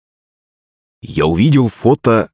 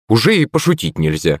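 plain reading voice

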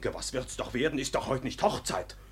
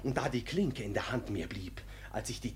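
hushed telling; 3 semitones lower, 8 dB quieter than usual. Acted. Ruprecht recounts breaking the door.

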